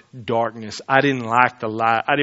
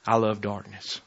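quiet confession; 6 semitones lower, 9 dB quieter, slower than usual. Retold or imitated. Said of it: "would you say".

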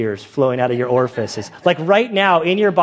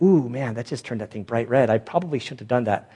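softer, guilty undertone; sheepish; 4 semitones lower, 5 dB quieter than usual. Retold or imitated. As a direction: admit it under your breath. Guy realizes his mistake.

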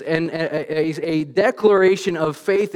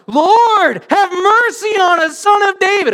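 full-volume high-pitched scream; top-of-the-lungs drawn-out shout; crying out; 9 dB louder, slower than usual. Shown as a demonstration. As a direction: bellow a desperate plea over a crowd. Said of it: "They are crying out".

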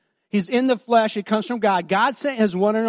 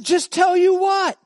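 puzzled; 10 semitones higher, 4 dB louder, slower than usual. Retold or imitated. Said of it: "I said".

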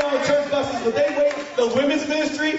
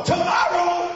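outraged yell; 7 semitones higher than usual. Retold or imitated. Teen yelling at the pastor about cotton candy. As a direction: protest in disbelief.

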